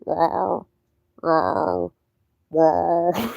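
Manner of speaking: hoarse, breathy cat yowl imitation; voiceless cat rawr. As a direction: rasp out a cat that lost its meow. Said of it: "he started going".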